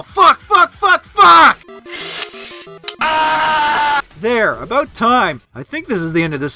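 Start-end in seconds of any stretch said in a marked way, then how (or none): 0.0-1.6 screaming curses, high and loud; full meltdown at the game; raging gamer. Real, not acted. Escalating rage. 3.0-4.0 raging scream. Real, not acted. Rage scream.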